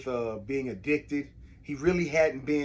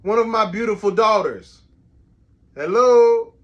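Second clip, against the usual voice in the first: calling out; 6 semitones higher, 12 dB louder than usual. Real, not acted. Dad calling his daughters from downstairs, real loud calling out. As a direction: holler names so they hear upstairs.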